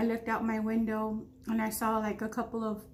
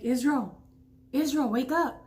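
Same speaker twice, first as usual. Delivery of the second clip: urgent wake-up call; 3 semitones higher, 4 dB louder than usual. Retold or imitated. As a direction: call him up out of sleep, urgent. Sister waking her sleeping husband.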